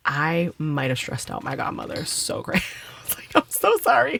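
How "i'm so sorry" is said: higher-pitched, mock-tearful apology; retold crying apology. Retelling how she apologized to her godmother after the chop, mock-weepy and high. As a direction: imitate your past self whimpering an apology. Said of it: "I boohoo cried".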